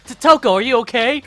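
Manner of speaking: high, loud cartoon princess voice; bright squealing princess; Blair voice. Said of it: "I'm gonna roll with that voice from now on".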